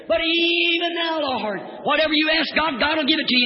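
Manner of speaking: soaring pulpit refrain; fervent faith cry. Acted. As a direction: cry it out like a revival preacher. Same refrain.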